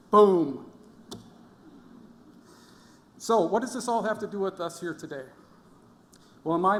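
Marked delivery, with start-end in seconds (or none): 0.1-0.7 sudden, very loud boom; explosive shout. Acted. Speaker jokes to chemistry majors about instability, explosive shout. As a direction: burst out like an explosion.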